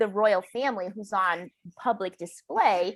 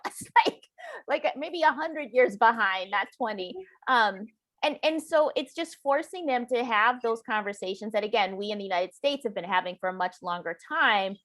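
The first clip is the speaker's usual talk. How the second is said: usual speaking voice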